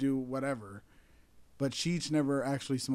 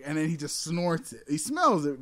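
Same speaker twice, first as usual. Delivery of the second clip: normal talking voice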